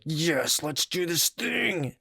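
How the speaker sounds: mock eager inner voice; eager urge voice. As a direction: let the impulse cheer you on. Body's urges voiced eagerly.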